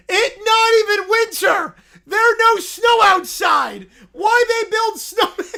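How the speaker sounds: high, loud indignant bear shout; broken-English bear. Acted. Little Brown Bear protesting at the screen, high and loud. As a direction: protest like an upset toddler. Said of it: "Why is he talking like this?".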